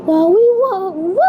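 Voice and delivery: ordinary delivery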